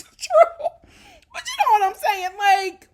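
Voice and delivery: loud laughter through words; laughing. Real, not acted. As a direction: crack up while speaking. Laughing at own claim.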